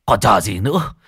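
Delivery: frantic high-pitched command; urgent angry order. A narrator's reading. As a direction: order them out at once, shouting. Old man orders an urgent search.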